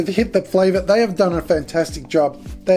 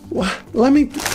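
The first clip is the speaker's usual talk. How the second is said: higher, incredulous "Lamington?"; surprised; 4 semitones higher than usual. Real, not acted. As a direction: say it like you can't believe it. Repeating the surprising flavour.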